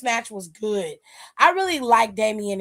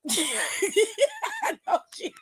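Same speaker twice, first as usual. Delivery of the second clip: laughing; 9 semitones higher, 5 dB quieter, much slower than usual. Real, not acted. Laughs picturing Nicole crying.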